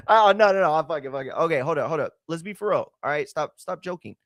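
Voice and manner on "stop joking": ordinary delivery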